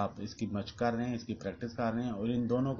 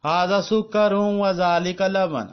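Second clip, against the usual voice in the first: slow, raised, emphatic teacher read-aloud; classroom drill reading; 10 semitones higher, 11 dB louder, slower than usual. Acted. Teacher reading the lesson sentences aloud to students, slow and emphatic. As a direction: spell out each sentence for students to repeat.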